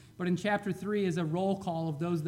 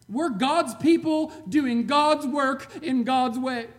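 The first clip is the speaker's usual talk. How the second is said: louder, slow and emphatic; defiant, loud retort; 5 semitones higher, 8 dB louder than usual. Retold or imitated. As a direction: stand your ground and send them away. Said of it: "And he said".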